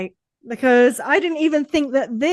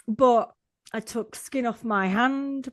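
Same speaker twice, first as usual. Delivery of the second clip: usual speaking voice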